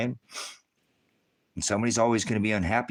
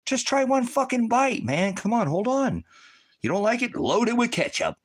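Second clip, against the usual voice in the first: pushy excited urging; 12 semitones higher than usual. Retold or imitated. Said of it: "You're like".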